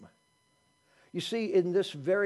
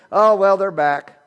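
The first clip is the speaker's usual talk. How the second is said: slow, loud, sarcastic "Oh, well, they're back"; grudging welcome; 4 semitones higher, 13 dB louder than usual. Retold or imitated. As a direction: drag it out like an unwelcome arrival. Mocking unwelcoming family at home.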